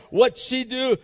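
usual speaking voice

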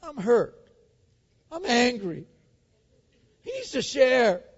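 high-pitched mock tantrum; childish whining. King sulking over a vineyard, imitated as a whining child. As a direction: pout it in short bursts like a wronged child. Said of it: "speak in the tone of a child".

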